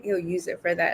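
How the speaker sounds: ordinary delivery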